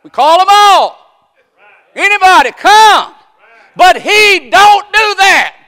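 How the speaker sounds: high, shouted preaching cry; full-force preaching. Acted. Preacher shouting to the congregation, full-force preaching. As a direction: preach it out to the whole church.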